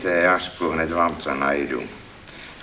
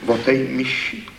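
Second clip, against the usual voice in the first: dejected; 6 semitones higher than usual. A narrator's reading. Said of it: "Pravil hlas malomyslně".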